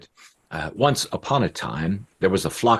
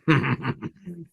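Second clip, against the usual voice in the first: higher, gloating villain chuckle; hunter's chuckle; 7 semitones higher than usual. A narrator's reading. Hunter watching the birds take his bait, gloating chuckle. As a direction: chuckle to yourself as a scheming hunter. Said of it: "And the hunter, he said".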